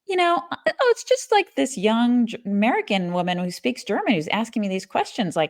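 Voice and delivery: breezy, high-pitched chatter; mock gossiping competitor. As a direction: play a guy spilling secrets without a second thought.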